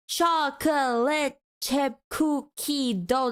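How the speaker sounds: "chocolate chip cookie dough" drawn out slowly, beat by beat; syllable by syllable. Shown as a demonstration. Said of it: "That's like seven syllables".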